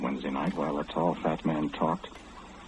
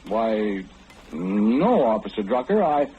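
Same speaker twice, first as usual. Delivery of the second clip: higher, prim and proper voice for the officer; lightly raised, overly correct phone manner; innocent polite citizen; 8 semitones higher than usual. Acted. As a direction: sound helpful and harmless, a put-on innocence. Caller switches voice for the policeman.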